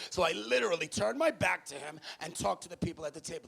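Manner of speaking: ordinary talk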